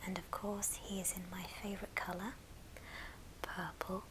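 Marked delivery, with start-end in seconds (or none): none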